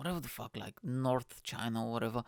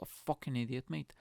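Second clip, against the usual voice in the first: normal talking voice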